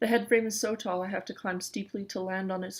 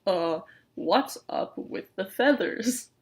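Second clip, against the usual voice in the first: slow, drawn-out wary question; said slowly; 3 dB louder, slower than usual. Acted. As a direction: stretch each word out, suspicious. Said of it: "the bearded raven says slowly".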